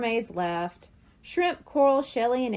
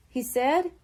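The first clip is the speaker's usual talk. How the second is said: plain reading voice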